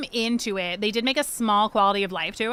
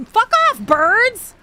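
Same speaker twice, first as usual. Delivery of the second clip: screamed high and loud at the birds; furious shout; 11 semitones higher, 7 dB louder, much slower than usual. Real, not acted. Streamer swarmed by birds, shouting high. Birds attack her in game.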